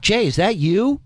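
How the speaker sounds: high, bright call into the crowd; calling out to a man at the back of the room; Robin calling out. Retold or imitated. Comedian calls to the back row, high and bright. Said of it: "And he goes".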